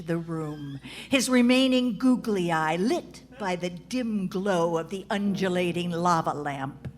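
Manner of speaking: mock-dramatic narration; story narration. A narrator's reading. Narrator setting a mock-dramatic scene, lightly ominous. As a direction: read it like a pulpy novel.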